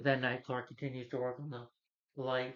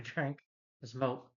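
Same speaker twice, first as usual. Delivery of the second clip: usual speaking voice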